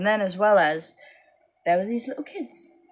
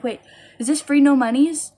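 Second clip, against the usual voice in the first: put-on high, louder childish question; little girl voice; 7 semitones higher, 7 dB louder than usual. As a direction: ask like an eager five-year-old. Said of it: "they're like".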